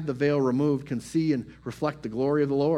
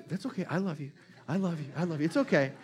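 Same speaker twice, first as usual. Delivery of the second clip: God comforting; 4 semitones higher, faster than usual. Retold or imitated. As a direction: comfort a worried child gently. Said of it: "he's gonna say".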